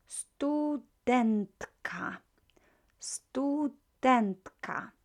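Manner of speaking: studentka whispered, very slow; slow model word. Shown as a demonstration. Teacher modelling studentka for class. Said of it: "teacher models word".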